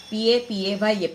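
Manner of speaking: ordinary talk, nothing marked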